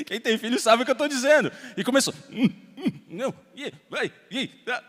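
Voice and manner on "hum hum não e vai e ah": mock muffled sobs of a little girl; imitated child whimpering. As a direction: whimper like a scared child in her room. Daughter sobbing, pleading.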